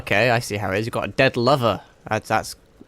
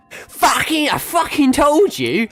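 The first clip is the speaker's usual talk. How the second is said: real outrage, voice up high; outraged rant; 12 semitones higher than usual. Real, not acted. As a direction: rant at the greedy shopkeeper. Ranting at greedy NPC.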